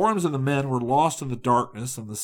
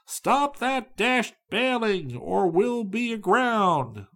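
higher, slow panicked call; a mate's mild mock terror, voice pitched up; terrified cry; 10 semitones higher, slower than usual. A narrator's reading. Mate cries to crew to stop bailing, pitched-up alarm. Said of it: "the mate's terrified cry".

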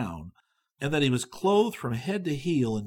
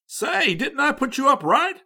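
eager chatter; 12 semitones higher, 6 dB louder than usual. A narrator's reading. Young man keeps chattering.

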